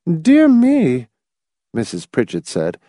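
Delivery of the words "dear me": put-on high, peevish old lady; pettish. A narrator's reading. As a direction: Mrs Pritchett is annoyed by the plane, peevish. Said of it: "brows drawing pettishly together".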